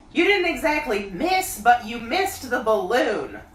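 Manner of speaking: high, loud bear calling down; Pooh voice. A narrator's reading. Pooh calling down to Christopher Robin, high bear voice. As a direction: call up from the honey tree like a bear. Pooh after being hit.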